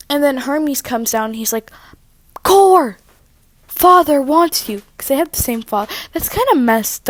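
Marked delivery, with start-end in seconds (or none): 2.4-4.8 loud, higher call to Kore. Acted. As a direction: summon her loudly as a messenger god. Hermes calls out to Kore.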